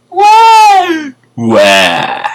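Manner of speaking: loud mock baby wail, first very high; badly imitated baby "wah"; baby crying. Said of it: "her baby started to cry".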